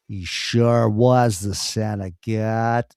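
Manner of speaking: slow, lazy American drawl as John Wayne; John Wayne impression. Impersonating a cowboy actor playing a Roman soldier in a slow drawl. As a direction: drag out every word like a cowboy centurion. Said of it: "Very authentic acting that was".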